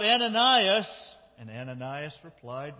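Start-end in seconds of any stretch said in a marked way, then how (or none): 0.0-0.9 high, loud call of a name; ringing, much louder call. Acted. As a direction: call out as if summoning someone from afar. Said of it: "he called out".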